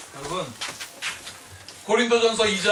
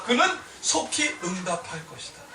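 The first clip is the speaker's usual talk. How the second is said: normal talking voice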